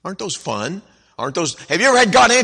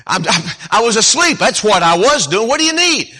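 loud, much higher, irritated complaint; annoyed neighbor; 9 semitones higher, 8 dB louder than usual. Acted. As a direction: snap at the late caller.

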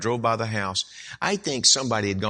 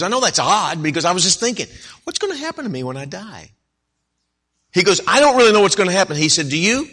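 put-on excited teenage boy; 8 semitones higher, 9 dB louder, faster than usual. Teen John voiced, anxious questions.

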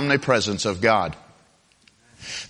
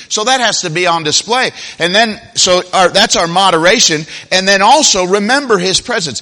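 higher, driving sermon delivery; emphatic preaching; 5 semitones higher, 5 dB louder than usual. Acted. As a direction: preach it with raised pitch and firm emphasis.

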